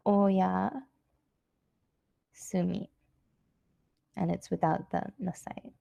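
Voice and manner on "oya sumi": "Oyasumi" split in two by a pause; slow demonstration. Shown as a demonstration. Teacher showing informal good night, "Oyasumi" slowly in two parts. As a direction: say "Oyasumi" slowly, pausing before "sumi".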